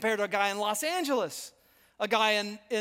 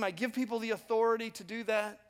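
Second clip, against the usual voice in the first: ordinary talk, nothing marked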